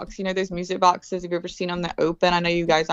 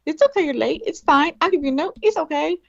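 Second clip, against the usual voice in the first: much higher, sugary reassuring mom voice; fake-sweet mom; 11 semitones higher than usual. Mother reassuring late kids, high sugary voice. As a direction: coo it like an overly sweet mom. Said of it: "I'm going".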